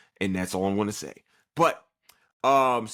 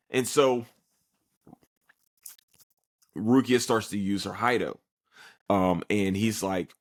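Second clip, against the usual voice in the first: ordinary delivery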